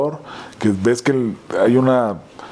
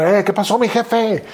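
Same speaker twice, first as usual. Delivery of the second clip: high-pitched imitated hail; acted chummy shout-out in a raised voice; over-familiar stranger; 11 semitones higher than usual. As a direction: pitch it way up, greeting the boss. Imitating stranger greeting him in bathroom.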